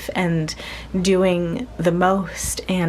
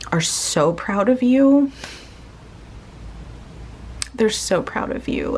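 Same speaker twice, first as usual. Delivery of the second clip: choked up; 3 semitones higher than usual. Real, not acted. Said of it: "I'm getting really emotional".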